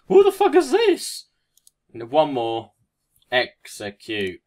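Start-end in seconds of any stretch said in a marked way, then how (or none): 0.1-1.3 very high, loud, laughing squawk; squeaky chicken voice. Acted. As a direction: squeal it like a baffled cartoon chicken. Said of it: "Looking like".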